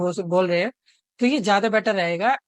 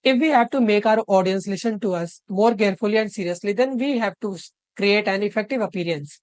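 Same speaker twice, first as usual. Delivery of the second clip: slow dictation; much slower than usual. Shown as a demonstration. Reads the key sentence slowly.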